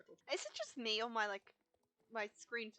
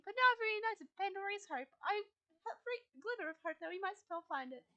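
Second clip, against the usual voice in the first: a slightly higher, tender guide voice; lightly voiced wise guide, comforting; gentle Fripp voice; 8 semitones higher, 6 dB louder than usual. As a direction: console the grieving rider as Fripp.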